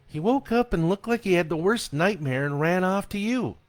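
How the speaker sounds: a mom explaining herself; defensive wife. A narrator's reading. Wife explains to her husband what happened, defensive.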